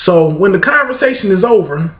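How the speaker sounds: normal talking voice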